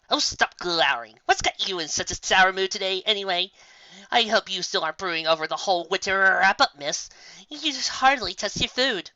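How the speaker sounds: a hint of a smug unicorn; chiding Trixie. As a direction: scold her lightly from on high.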